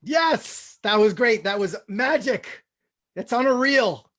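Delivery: usual speaking voice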